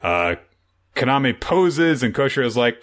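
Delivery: ordinary delivery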